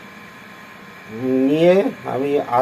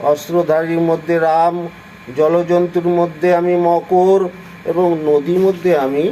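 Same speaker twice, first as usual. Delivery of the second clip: ordinary delivery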